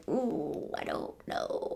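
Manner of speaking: whispered, unsure hesitation; doubtful hush. Retold or imitated. Recalling her doubts in the first fifteen minutes, hushed and unsure. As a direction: murmur it like you're secretly losing faith.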